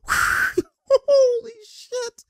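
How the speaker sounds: very high, very slow drawn-out disbelief; stunned laugh. Real, not acted. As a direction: stretch it out, stunned at the play. Laughing at a bad play.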